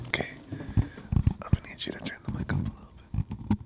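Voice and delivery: soft breathy ASMR whisper. Pretend. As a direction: whisper close into the mic. ASMR whisper bit at mukbang.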